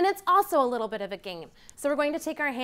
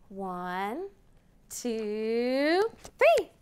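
building countdown; much slower than usual. Acted. Teacher counting to children, building to an excited three.

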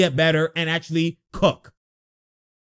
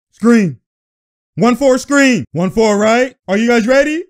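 calling the play out loud and higher; 4 semitones higher, 8 dB louder than usual. Real, not acted. Fan yelling plays at the TV, loud and high. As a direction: shout it at the screen like a coach.

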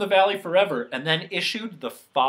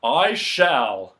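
loud, firm declaration; volunteer with resolve; resolute; 3 semitones lower, 8 dB louder than usual. A narrator's reading. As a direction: punch it out decisively. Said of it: "with a resolute".

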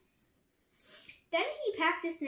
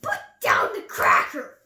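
loud, forceful shouted order; Rabbit crying out; 2 semitones higher, 12 dB louder than usual. A narrator's reading. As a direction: yell it as an outraged command. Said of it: "cried Rabbit".

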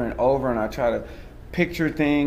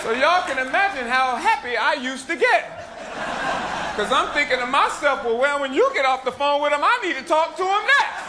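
higher-pitched, projected stage storytelling; stand-up performance; 13 semitones higher than usual. Acted. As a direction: work the crowd with a mock confession.

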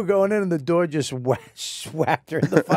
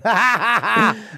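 mock laughter; 6 semitones higher, 3 dB louder, slower than usual. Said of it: "fucking eight people going".

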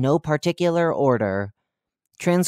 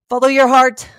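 shouted very high and loud; frantic last words before cutting off; panicked sign-off; 10 semitones higher, 8 dB louder than usual. Acted. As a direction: yell the catchphrase in terror. Sign-off after the bee attack.

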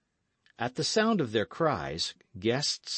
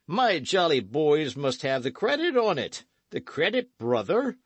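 put-on loud, higher, slurring drunken brag; drunken outlaw; 7 semitones higher, 4 dB louder than usual. Drunk outlaw crowing to young Dick. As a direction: boast like a tipsy old rogue.

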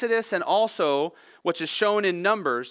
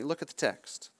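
soft aside; 2 semitones lower, 9 dB quieter than usual. Real, not acted. Quiet aside after the quote.

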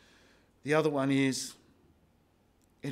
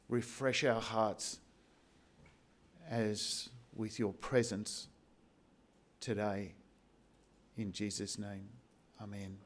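soft, hushed praying voice fading low; prayer; 3 semitones lower, 5 dB quieter than usual. Acted. Pastor leads the congregation in closing prayer, softly. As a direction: pray gently, letting it sink away.

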